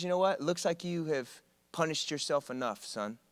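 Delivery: softly voiced kind professor; gentle pardon. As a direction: forgive him gently.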